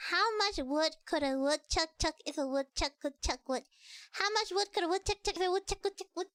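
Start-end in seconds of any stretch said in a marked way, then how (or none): 4.1-6.3 fast tongue twister. Shown as a demonstration. Showing off to chat, rattling off the twister fast. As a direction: rattle it off at speed.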